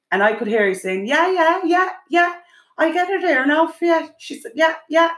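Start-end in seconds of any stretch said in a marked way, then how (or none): 1.0-5.1 mimicked phone voice. Imitating daughter's phone answers.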